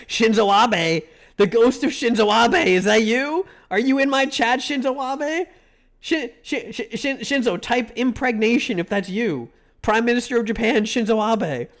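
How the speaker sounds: loud, high, excited yelling; calling out to a ghost in a mock frenzy; excited shouting. Streamer yelling at the ghost of Shinzo Abe in chat, excited shout.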